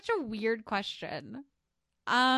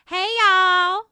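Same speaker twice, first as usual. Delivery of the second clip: very loud, high Southern holler; mock Southern accent; 8 semitones higher, 14 dB louder than usual. Co-host is fond of Southern, loud mock Southern greeting. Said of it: "I'm fond of Southern accents".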